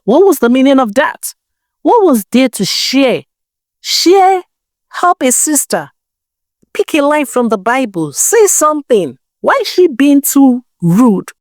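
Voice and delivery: pitched high, fuming at the snub; indignant outburst. Acted. Tenny fumes to herself after Missus Adegoke refuses advice, indignant and high. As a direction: let her indignation spill over, question after question.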